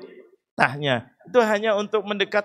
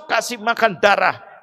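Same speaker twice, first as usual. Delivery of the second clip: ordinary talk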